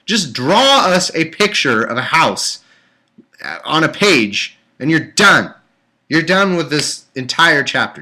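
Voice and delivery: loud, high, fed-up yelling at the book; exasperated shout. Real, not acted. Reader yelling at the Bible author, exasperated shout. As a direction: vent your frustration at the writer.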